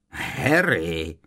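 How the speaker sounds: mocking drawn-out name; taunting echo of a cry; jeering mimicry. A narrator's reading. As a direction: mimic someone's call with a sneer. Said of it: "poškleboval se Kreb".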